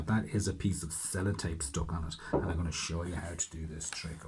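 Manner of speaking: usual speaking voice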